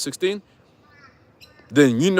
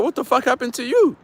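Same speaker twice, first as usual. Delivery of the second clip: incredulous outburst; 8 semitones higher than usual. Retold or imitated. Mock question to a changed friend.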